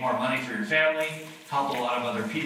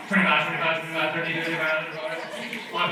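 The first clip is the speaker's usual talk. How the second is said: rapid mock auctioneer bidding call; 2 semitones higher than usual. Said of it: "I feel like I should be, like, an auctioneer right now".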